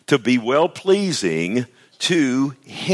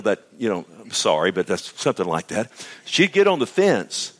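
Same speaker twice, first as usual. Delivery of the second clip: ordinary talk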